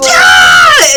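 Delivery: very high, very loud whoop; cheering yell. Acted. Kids take off in chase, screamed cheer. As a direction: explode into a kids' charging cheer.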